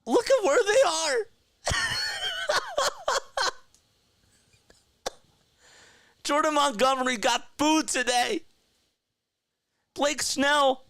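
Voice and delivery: high, giddy laughing mockery; gleeful mocking laughter. Real, not acted. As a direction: gloat through the laughter, pitch way up. Mocking rival signings, laughing.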